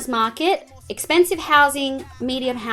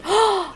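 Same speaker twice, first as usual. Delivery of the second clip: sudden excited gasp; sharp gasp; 9 semitones higher than usual. Real, not acted. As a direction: gasp as an idea strikes. Sudden idea of a bank.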